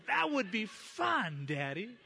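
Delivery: put-on high, slow, excited little boy voice; young son eagerly accepting a nap with Daddy, high and delighted. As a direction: play the thrilled small child. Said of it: "He goes".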